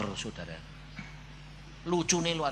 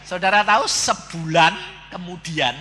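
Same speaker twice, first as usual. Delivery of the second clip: usual speaking voice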